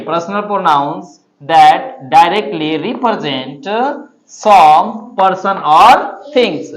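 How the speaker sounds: slow, stretched recitation. Shown as a demonstration. Teacher repeating the definition slowly for memorising. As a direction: say it again slowly. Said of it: "फिर से एक बार देखिए".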